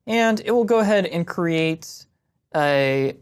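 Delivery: usual speaking voice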